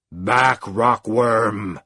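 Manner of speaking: lightly voiced deep menacing command; a hint of an abyss speaking. A narrator's reading. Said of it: "It was deep and resonant".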